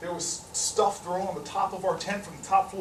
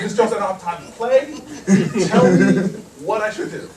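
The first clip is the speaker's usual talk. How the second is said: loud, impatient plea; exasperated demand; 8 dB louder than usual. Retold or imitated. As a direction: burst out, fed up with the teasing. Exasperated demand in retold exchange.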